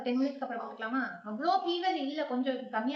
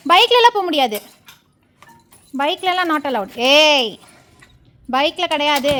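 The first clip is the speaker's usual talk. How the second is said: raised-voice scolding; firm refusal; 15 dB louder, slower than usual. Real, not acted. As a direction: call out a firm no to a pet. Refusing the dog a bike ride.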